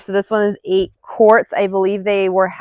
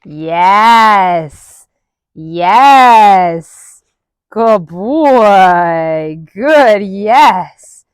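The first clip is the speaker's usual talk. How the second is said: louder, firm marker praise for the dog; 6 dB louder, much slower than usual. Real, not acted. Trainer marking the dog's step with loud praise. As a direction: mark it loud and clear.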